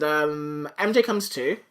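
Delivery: usual speaking voice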